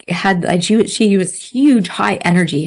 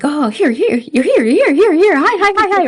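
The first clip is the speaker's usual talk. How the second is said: high, rising, ever louder puppy yips; excited puppy; 10 semitones higher, 4 dB louder than usual. Retold or imitated. Like a puppy greeting her, high and yipping with excitement. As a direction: bounce like an overjoyed puppy. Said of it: "it's like a puppy".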